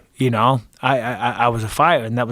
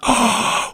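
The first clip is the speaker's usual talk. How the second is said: gasp; 9 semitones higher than usual. Retold or imitated. Feeling the punch land.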